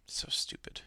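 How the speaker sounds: unvoiced whisper of disgust. Real, not acted. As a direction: breathe it out in disgust. Muttered after a sigh.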